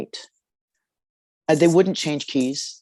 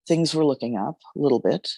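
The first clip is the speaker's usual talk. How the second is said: ordinary delivery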